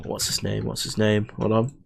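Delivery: soft, low mutter to yourself; muttered aside. Real, not acted. YouTuber trying to recall a name for a shout-out, muttered low. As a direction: drop your voice while searching your memory.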